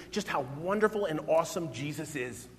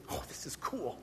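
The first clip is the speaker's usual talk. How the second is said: excited whisper; 3 semitones lower, 11 dB quieter than usual. Real, not acted. Excited aside to congregation.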